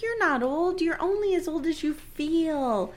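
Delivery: lightly voiced flirty Molly; mock Molly. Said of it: "Molly's like".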